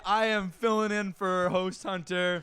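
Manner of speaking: hyped shout. Acted. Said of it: "you gotta give it some more".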